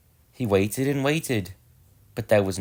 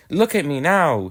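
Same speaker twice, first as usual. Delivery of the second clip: proud little engine; 7 semitones higher, 5 dB louder than usual. Acted. Edward proud while puffing.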